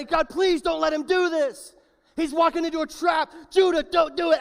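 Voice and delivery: urgent high shout of warning; yelling at him. Acted. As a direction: scream at a friend to stop him. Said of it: "I would be yelling at him".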